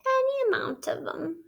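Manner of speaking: high mock-sobbing wail. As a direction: wail it like a fake sob. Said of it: "sobbed Toad".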